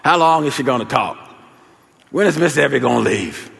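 lightly acted weary kids' whine; mock complaining. Kids upstairs waiting for the neighbor to go, mock complaining. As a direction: grumble it like kids kept waiting. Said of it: "we started complaining".

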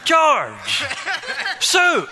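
loud, high call with a falling tail; calling out names. Pretend. Preacher calling out names to a listener, calling out. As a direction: call out a name as if summoning someone.

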